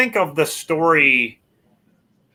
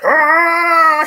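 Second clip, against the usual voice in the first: high, loud, drawn-out "ah" scream; sustained high-pitched mock scream; imitated yell; 12 semitones higher, 7 dB louder than usual. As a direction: mimic the doll's long yell, high and loud. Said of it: "He just yells like".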